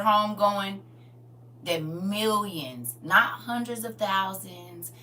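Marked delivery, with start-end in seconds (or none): none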